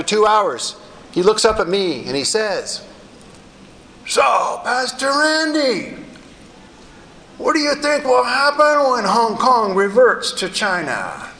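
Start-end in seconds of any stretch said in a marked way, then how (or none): 4.1-11.3 put-on high, drawn-out voice of a teen; imitated teenager, slow and high-pitched; teenage gang member. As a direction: pitch it up and stretch out the question. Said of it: "this is a seventeen-year-old gang member".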